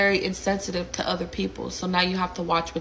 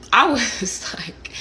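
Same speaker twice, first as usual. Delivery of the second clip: breathy laugh; 3 semitones higher, 4 dB louder, much slower than usual. Real, not acted. Sighing, laughing reaction to the joke.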